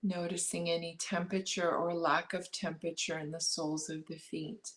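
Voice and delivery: calm meditation guide, long pauses between phrases; unhurried body-scan guidance, leaving space after each instruction; guided meditation. Acted. Guiding listeners to notice breath and body, guided meditation.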